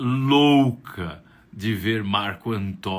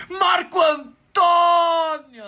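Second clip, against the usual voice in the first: very high, loud, slow shout of his name; old lady at the bus stop shouting his name in high delight; elated shout; 10 dB louder, much slower than usual. Acted.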